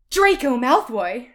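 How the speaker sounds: loud, drawn-out name announcement; hammed-up big reveal, slow and loud; dramatic reveal. Ebony reveals who called her, loud and dramatic.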